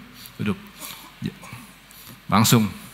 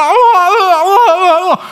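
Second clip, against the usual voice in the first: exclaiming; 5 dB louder, slower than usual. Real, not acted. Exclaiming at the stubborn friend.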